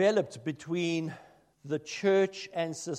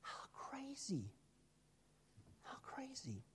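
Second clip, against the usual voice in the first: stage whisper; 8 semitones higher, 21 dB quieter than usual. Real, not acted. Minister marvelling at his neighbor, in a whisper. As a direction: hush it like a secret.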